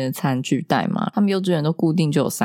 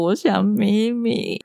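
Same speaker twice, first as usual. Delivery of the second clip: put-on slow, higher, whimpering 我想咪咪 like a small boy; lightly voiced sobbing toddler; mother retelling her son crying for mommy, a mock whimper; imitating a crying child; 5 semitones higher, much slower than usual. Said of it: "边吃饭边哭说".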